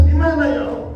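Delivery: high pleading cry of the mourning earth; lamenting earth, crying out high. Acted. Preacher voicing the earth mourning a pious man, high lament. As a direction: wail it like the earth searching for him.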